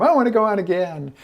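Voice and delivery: high, eager mock plea; eager excitement.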